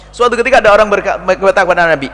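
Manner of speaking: ordinary delivery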